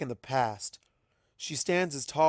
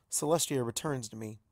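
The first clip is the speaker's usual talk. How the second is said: plain reading voice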